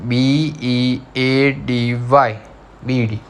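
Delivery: very slow letter by letter. Shown as a demonstration. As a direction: drag each letter out for the class. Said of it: "Now number second is".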